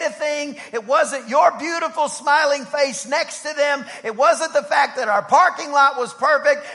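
loud, high preaching build; fiery preaching. Acted. Preacher rousing the congregation with a loud charge. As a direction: preach it hard to the church.

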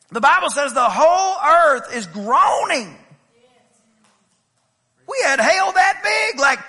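loud, fervent preaching. Acted. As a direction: build the sermon with fire. Preaching at full force.

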